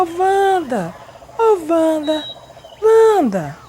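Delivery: high, loud call of a name from the gate, growing to the last Wanda. Retold or imitated. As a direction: shout her name across the yard from the gate. Aunt calling from the gate. (chamando alto do portão, cada vez mais forte)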